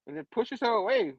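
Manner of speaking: usual speaking voice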